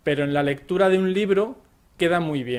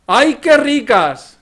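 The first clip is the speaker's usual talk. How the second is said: much higher, louder, slow gushing "¡Ay, qué ricas!"; delighted exclamation; 7 semitones higher, 11 dB louder, slower than usual. Shown as a demonstration. Hearing croquetas are for dinner, a gushing, delighted cry. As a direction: swoon over the croquetas.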